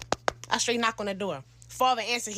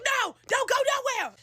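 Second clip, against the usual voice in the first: high-pitched mock yell; yelling mother; 12 semitones higher, 3 dB louder than usual. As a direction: imitate a mom stopping a car. Retells mother yelling at car.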